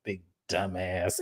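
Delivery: low slow muttered insult; muttered aside. Retold or imitated. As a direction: say it under your breath in disbelief. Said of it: "I'm looking at her like".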